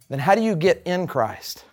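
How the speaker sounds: usual speaking voice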